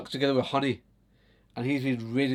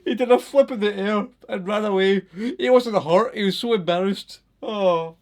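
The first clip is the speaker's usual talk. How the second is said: higher, louder, laughter-laced; laughing through words; 7 semitones higher, 7 dB louder than usual. Real, not acted. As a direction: still giggling while telling it. Still laughing at the story.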